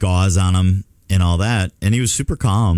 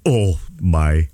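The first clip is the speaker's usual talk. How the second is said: retold stunned shock; 3 semitones lower, much slower than usual. Retold sight of the open wound.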